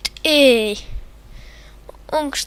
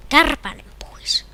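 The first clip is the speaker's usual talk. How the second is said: breathy whisper to a fly; 3 semitones higher than usual. Real, not acted. Gamer whispering at a fly mid-match, whispered. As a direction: hush it away. Shooing a fly while playing.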